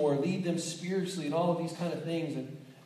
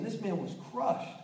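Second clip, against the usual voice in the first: ordinary talk, nothing marked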